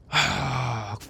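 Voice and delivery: long voiced sigh of frustration; dejected sigh. Real, not acted. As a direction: let out a heavy sigh. Sighs over the broken truck.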